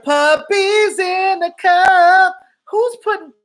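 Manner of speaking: high, slow mock charity-ad voice; imitating a comedian mocking animal ads; Patrice O'Neal bit. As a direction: drag it out like a sappy ad. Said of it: "he was like".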